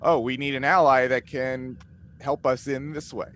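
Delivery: normal talking voice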